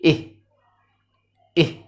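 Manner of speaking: "إيه" as the short I of ink, "ih"; letter sound demo. Shown as a demonstration. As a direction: sound out "إيه" cut short, like a little laugh. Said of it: "بضحك كده". (نطق "إيه" كصوت I القصير، كأنك بتضحك)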